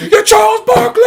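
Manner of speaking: hammed-up breakdown at the mirror; mock anguished yell. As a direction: scream it at your reflection. Punching the mirror.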